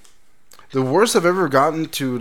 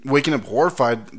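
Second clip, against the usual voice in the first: normal talking voice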